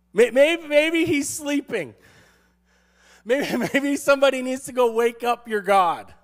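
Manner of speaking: loud, high teasing suggestion; hammed-up teasing of a rival's god; mock taunting. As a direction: needle them with pretend helpfulness. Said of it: "He's taunt".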